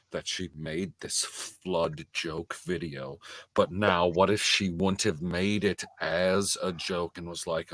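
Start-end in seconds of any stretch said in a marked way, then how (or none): none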